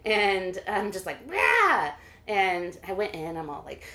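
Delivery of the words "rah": higher, louder growled roar; mock roar. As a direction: roar in his face. In his face, mock roar.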